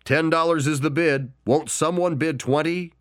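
high, hopeful auction call; auctioneer calling. Acted. Auctioneer asking for more.

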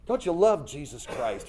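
ordinary talk

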